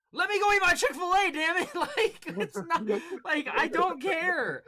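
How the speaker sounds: loud, high, laughing exasperation; exasperated outburst. Retold or imitated. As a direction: vent the frustration half laughing. Exasperated retold reply, laughing.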